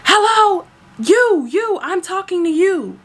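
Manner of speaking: high, demanding mock yell; high-pitched insistent calling out, hammed-up; imitated screaming. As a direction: act out a passenger yelling for attention. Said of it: "she's already screaming".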